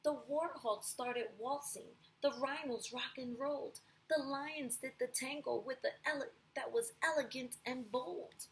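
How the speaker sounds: lively storybook read aloud; storyteller voice for a class; reading to children. A narrator's reading. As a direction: read it like a picture book for kids. Reads picture book to class.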